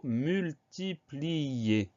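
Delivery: multiplier broken into slow, drawn-out syllables; slow dictation. Shown as a demonstration. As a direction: stretch every syllable of multiplier. Teacher presents word to spell.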